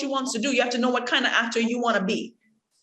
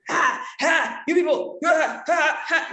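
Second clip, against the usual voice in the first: a hammed-up angry outburst, half-shouted; mock angry stomping; 4 semitones higher, slower than usual. Shown as a demonstration. Teacher demonstrates bad angry emoting, mock shouting. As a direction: stomp and bluster in fake anger. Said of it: "No, that is not it".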